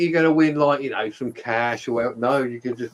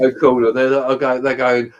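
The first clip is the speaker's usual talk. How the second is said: ordinary delivery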